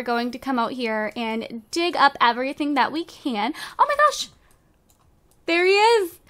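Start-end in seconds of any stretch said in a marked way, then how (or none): 3.8-6.2 high, excited squeal of delight; excited greeting. Real, not acted. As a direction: greet him like a long-lost friend. Neighbour Noah arrives at door.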